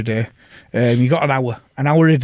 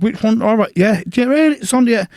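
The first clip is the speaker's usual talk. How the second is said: high, cocky taunting before a punch; cocky challenge; 9 semitones higher, 3 dB louder than usual. Retold or imitated. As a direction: goad him into choosing a side. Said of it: "I said".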